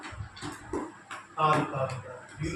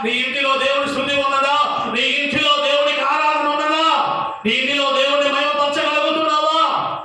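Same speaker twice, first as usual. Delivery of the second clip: loud, raised preaching voice; preaching at full force; 7 semitones higher, 5 dB louder than usual. Acted. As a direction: drive the story home to the church.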